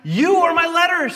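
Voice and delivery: high, loud ringing declaration; emphatic Paul. Retold or imitated. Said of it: "You know what Paul says?".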